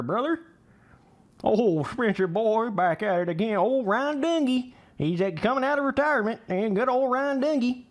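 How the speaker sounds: put-on high-pitched old country boy accent. Streamer declines a Canadian accent request, doing country boy accent instead. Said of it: "Only accents I do is old country boy accents".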